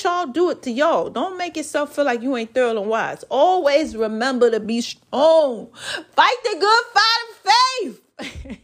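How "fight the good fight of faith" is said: higher, slow rising exhortation; preachy uplift. Acted. Urging listeners, rising slow preacher exhortation. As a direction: lift it like a sermon line.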